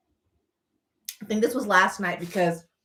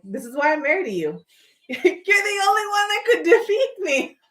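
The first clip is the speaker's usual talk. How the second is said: laughing through the words; giggling, voice cracking high; 8 semitones higher, 3 dB louder than usual. Retold or imitated. Retold loving line, laughing.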